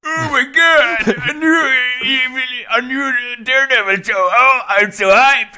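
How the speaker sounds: high, loud, slow hyped fanboy voice; Daredevil voice. Pretend. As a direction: gush like an overexcited fan. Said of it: "Stop using that voice".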